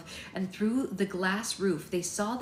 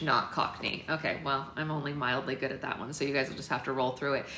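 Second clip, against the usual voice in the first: ordinary delivery, nothing marked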